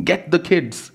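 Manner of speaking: urgent plea. Acted. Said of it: "panting and puffing".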